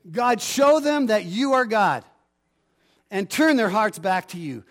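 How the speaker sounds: loud, high call to God; rousing prayer. Acted. Pastor leading the congregation to pray aloud, rousing. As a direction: lead the church in a rising prayer.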